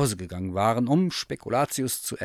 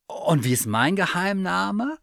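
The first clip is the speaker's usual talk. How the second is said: higher curious bear voice; eager teddy bear; 9 semitones higher, 5 dB louder than usual. Acted. Bear asks the cat about her wish, high and eager. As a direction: ask like a hopeful little bear.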